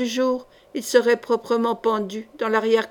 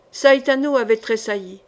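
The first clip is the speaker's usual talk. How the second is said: ordinary delivery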